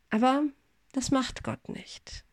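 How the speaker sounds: ordinary talk, nothing marked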